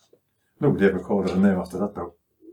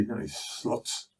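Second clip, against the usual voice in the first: muttered insult; 2 semitones higher, 10 dB quieter, much slower than usual. Retold or imitated. Retelling the insult.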